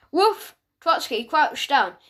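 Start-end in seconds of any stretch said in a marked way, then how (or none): 0.1-0.5 one high, sharp spoken woof; dog bark. A narrator's reading. Dog answers the cat's request, barking woof.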